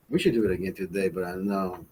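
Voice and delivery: ordinary delivery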